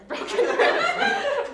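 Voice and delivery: can't hold back the giggle; laughing. Real, not acted. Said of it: "I'm sorry for laughing".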